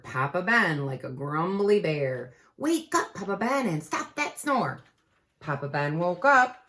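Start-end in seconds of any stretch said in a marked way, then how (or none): 2.6-4.8 higher pleading wake-up call; lightly voiced Jack calling; a hint of a kid calling out; child calling out. Calling Papa Ben.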